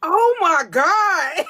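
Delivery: high, loud, drawn-out amazed cry; amazed delight. Real, not acted. Host reacting to the accent demo, amazed delight. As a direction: squeal it out, stretched and thrilled.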